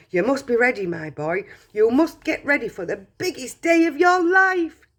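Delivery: put-on high, loud old man rattling off orders; a giddy grandfather bossing the boy about; excited Grandpa Joe. Grandpa Joe's rapid orders.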